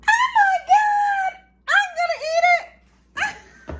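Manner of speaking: very high, slow, loud squeal; panicked shout. Real, not acted. To viewers about eating the fish, a high slow squealing shout. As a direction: shriek it in mock panic. Staring at her messy sandwich.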